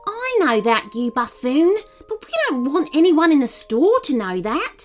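high, irritated scolding; angry reply. A narrator's reading. Nin scolds the man over the pistol, angrily. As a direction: tell off a bungling sidekick. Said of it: "Nin replied angrily".

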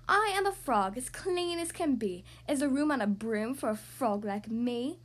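ordinary delivery

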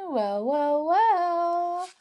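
drawn-out teasing well well well. Real, not acted. Teasing riddle build-up.

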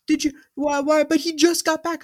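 high-pitched imitation of protesting fans; mock incredulity. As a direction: stammer out a fan's baffled objection. Imitating fans objecting to his lineup.

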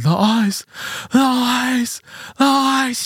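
high, panicked repeated cry; freaking out. Retold or imitated. Drugged son in the car sees brake lights: panicked high cry. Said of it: "He just starts flipping out".

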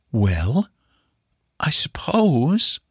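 slow, hesitant mother with drawn-out words; slow and bitter. A narrator's reading. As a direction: draw it out reluctantly, with a touch of bitterness. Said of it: "she said slowly and bitterly".

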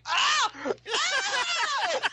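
very high imitated shriek of panic; hammed-up high scream tipping into laughter; freaked-out scream. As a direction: shriek like someone just pepper-sprayed. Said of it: "Just freaking out".

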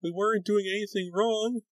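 put-on high small frightened boy; a scared little boy pleading; trembling tiny voice. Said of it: "in a trembling, tiny voice".

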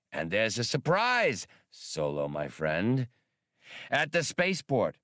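smooth-talking alien hustler; lightly voiced green primate trader; alien wheeler-dealer. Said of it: "then brightened".

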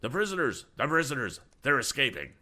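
high, loud cries of alarm; urgent guard's alarm shout. A narrator's reading. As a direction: raise the alarm in short bursts.

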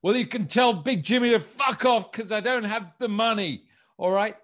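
high, loud cocky brush-off; mock defiant shout. Debtor telling the enforcer to get lost, loud and high. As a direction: ham up a debtor telling him off. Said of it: "I said".

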